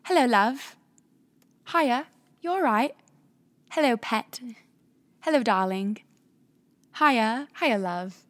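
put-on playful British accent, pet names sung out; imitating British greetings. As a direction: play a friendly London shopkeeper in a British accent. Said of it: "And they go".

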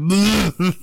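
imitated retching noise; mimed gag. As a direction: act out gagging at a stench. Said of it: "I never gag".

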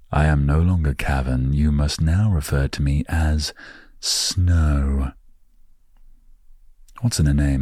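ordinary talk, nothing marked

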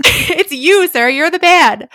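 high, loud, pointed accusing shout; shouting at the fictional husband that he is the one; loud mock accusation. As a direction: call him out at full volume.

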